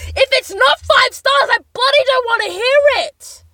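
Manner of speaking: loud, high-pitched comic shouting; belted-out joking threat to listeners; mock outraged yell. Mock threat to low raters.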